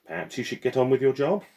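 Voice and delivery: lady putting a servant in his place; haughty dismissal. Acted. Madame dismisses porter.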